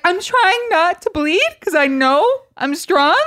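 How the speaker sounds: strained brave victim. Pretend. Bitten victim reassures the crowd, high and strained. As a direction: squeeze it out bravely. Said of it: "And the person's like".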